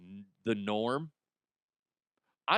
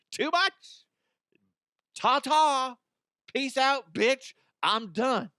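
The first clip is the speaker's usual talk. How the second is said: much higher and louder, a sassy parting shot; sassy mock farewell; 12 semitones higher, 4 dB louder, slower than usual. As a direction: flounce out of the date with a mocking goodbye. Mocking exit from the date.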